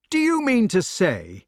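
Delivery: high, incredulous challenge. A narrator's reading. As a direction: call the question down.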